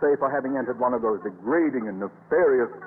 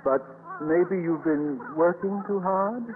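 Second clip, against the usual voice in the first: slow worried concern; 3 semitones higher, slower than usual. Acted. Principal alarmed by Miss Brooks.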